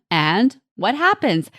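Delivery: ordinary delivery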